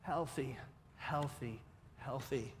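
softly repeated, falling each time; a whispered-down plea for a healthy baby; hushed prayer. Retold or imitated. Pastor recalling his prayer, quiet and earnest. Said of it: "what my prayers changed to".

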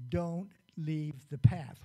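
stern warning. Retold or imitated. Mother warning her daughter not to leave the path, slow and firm. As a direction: lay down the rule slowly and firmly.

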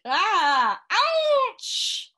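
pained yelp rising into a shout; hammed-up howl of pain and fury. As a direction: cry out in pain, then threaten loudly. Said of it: "shouted Missus Twit".

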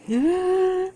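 sharp high gasp of delight. Real, not acted. A cook seeing her finished turkey, high delighted gasp. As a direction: catch your breath at the sight of it.

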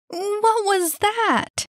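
very high, slow, stretched-out cry; alarmed cry. A narrator's reading. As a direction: cry out in shock after a scare. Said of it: "cried Annie".

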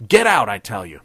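loud furious shout. A narrator's reading. Captain shouting at the private to get out. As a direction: shout him out of the room. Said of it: "He shouted".

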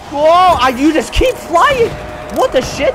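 amazed. Real, not acted. Enemy sent flying unexpectedly.

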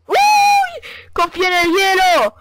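high, loud joyful yell; triumphant shout. Real, not acted. Saved by the ice. (grito triunfal)

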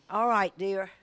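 ordinary talk

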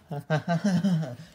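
loud burst of laughter; laughing. Acted. Prankster finds the alligator scare funny, laughing. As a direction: laugh at their panic. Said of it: "Stop laughing".

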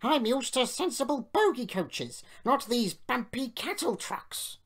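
high, loud indignant complaint; put-upon engine's protest; grumbling. A narrator's reading. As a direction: whine in snobbish outrage. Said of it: "he grumbled".

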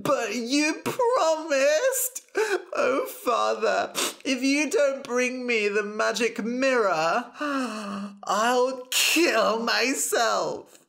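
high hammed-up sobbing tantrum; crying and shouting. A narrator's reading. Princess threatening her father, loud high tearful wail. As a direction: wail it slowly through theatrical sobs. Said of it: "started crying again, shouting".

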